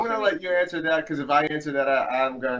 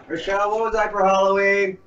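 raised, louder call; yelling to another room; 6 semitones higher, 5 dB louder than usual. Real, not acted. Asking Rochelle in the other room about Halloween, yelling. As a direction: call out to someone out of sight. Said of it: "I gotta yell in the other room".

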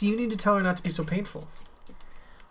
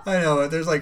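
usual speaking voice

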